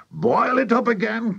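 high, urgent cry; excited cry. A narrator's reading. Mister Cranky directing the boiling, excited high cry. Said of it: "cried Mister Cranky".

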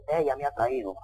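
low, feeble mock excuse; drippy suitor. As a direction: play the dopey coward passing the blame. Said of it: "hay otros que son más babosos".

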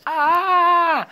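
long, high-pitched held "aah"; acted tantrum yell. Teacher shows the class how he used to scream, mock tantrum yell. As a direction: yell like a spoiled child, high and sustained. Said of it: "Yo pegaba a mis hermanos".

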